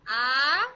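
आ held long and loud; a drawn-out, emphatic आ; madd demonstration. Shown as a demonstration. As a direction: sustain आ as one strong, long vowel. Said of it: "यानी मद बदल आ गई".

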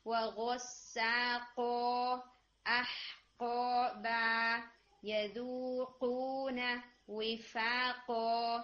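each word drawn out slowly, with the qaf in وِفَاقًا and أَحْقَابًا hit hard; strong qaf. Shown as a demonstration. Teacher drills a strong qaf, one word at a time. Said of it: "تھوڑا سا ہٹ strong رکھیں".

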